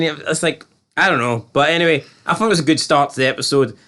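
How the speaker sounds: ordinary talk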